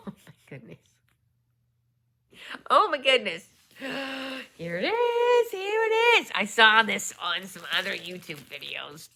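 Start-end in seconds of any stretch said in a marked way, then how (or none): 2.7-6.3 high, loud gasping delight; real excited squeal. Real, not acted. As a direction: squeal it out, thrilled to finally see it. Unboxing a long-awaited folio.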